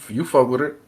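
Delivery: normal talking voice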